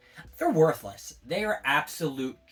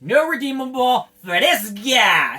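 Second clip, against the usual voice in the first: frustrated outburst; 7 semitones higher, 8 dB louder, slower than usual. Real, not acted. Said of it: "I'm flipping out".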